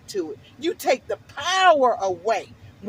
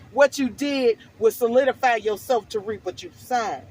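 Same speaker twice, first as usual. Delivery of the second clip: ordinary talk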